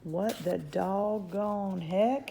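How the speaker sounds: slow, drawn-out cowboy astonishment; bewildered cowboy. Acted. Cowboy staring up at giant fingers, bewildered. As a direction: stretch it out in baffled disbelief.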